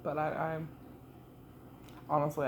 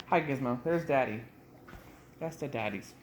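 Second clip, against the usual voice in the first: normal talking voice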